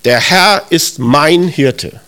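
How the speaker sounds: very slow verse with weight on "mein"; slow right down and claim "mein" personally; stress on MEIN. Shown as a demonstration. Preacher claims a personal relationship, slow stress on "mein". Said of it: "das ist eine persönliche Beziehung".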